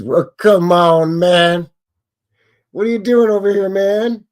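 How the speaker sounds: playful mock outrage; mock exasperation. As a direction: scold the building like a buddy, laughing. Mock scolding the silly building.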